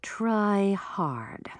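hushed, icy command; cold voice. A narrator's reading. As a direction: give the order coldly, almost whispered. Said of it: "her voice cold".

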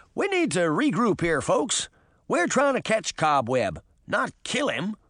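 exasperated but firm address; Hampstead, firm leader. Acted. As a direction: take charge of the squabbling team.

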